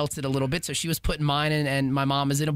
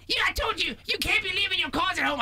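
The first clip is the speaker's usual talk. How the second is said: mock yell, nagging about the wallet; hammed-up scolding, not a full scream; retold scolding yell; 13 semitones higher than usual. Wife scolding husband in Walmart for forgetting his wallet, mock yell. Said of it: "she's screaming at me".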